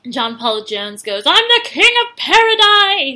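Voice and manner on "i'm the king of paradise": shouted high and loud like a proud king; triumphant boast. Retold or imitated. John Paul Jones shouting his triumph on the beach, high and loud. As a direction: crow it to the whole beach.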